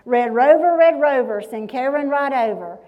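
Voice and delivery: loud playground chant, called out; hollering. Acted. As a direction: holler it across to the other team. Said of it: "and they holler".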